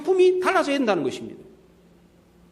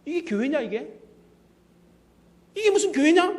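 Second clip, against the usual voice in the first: normal talking voice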